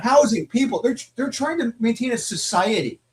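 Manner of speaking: high-pitched indignant comeback; exasperated retort. Acted. Reader talking back to the lecturing teen, exasperated retort. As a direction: argue back in exasperated disbelief.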